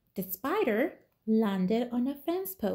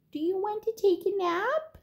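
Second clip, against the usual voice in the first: lightly voiced cat; 9 semitones higher, faster than usual. Cat asks the spider.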